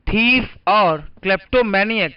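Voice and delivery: words drawn out very slowly; slow emphasis. Shown as a demonstration. Teacher reintroducing the two words, slowly. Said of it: "आपने लिख लिया होगा".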